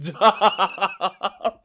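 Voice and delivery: starts talking, dissolves into laughing; breaking into laughter. Real, not acted. Host recalling a guest's story about a dude at his job, cracking up. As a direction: lose it laughing toward the end.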